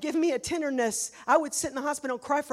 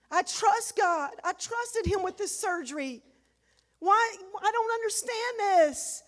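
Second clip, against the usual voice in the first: anguished plea; 7 semitones higher, slower than usual. Retold or imitated. Retold anguish at God.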